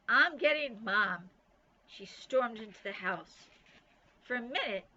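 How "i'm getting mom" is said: loud, slow threat; angry declaration. A narrator's reading. Nancy tells Bean she is getting Mom, loud and slow. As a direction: spell out the consequence.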